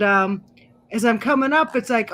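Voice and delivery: ordinary delivery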